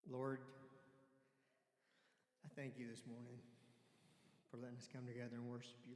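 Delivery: fervent spoken prayer. Acted. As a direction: lift the voice to call on the Lord. Begins to pray aloud.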